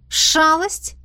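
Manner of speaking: high, rising, wary question; suspicious. A narrator's reading. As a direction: query the word with suspicion. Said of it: "сощурилась, окинув дракона подозрительным взглядом". (подозрительно переспросить)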